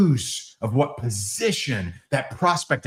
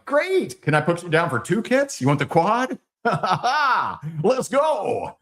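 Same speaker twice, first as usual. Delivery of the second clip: mock eager pitch; 6 semitones higher than usual.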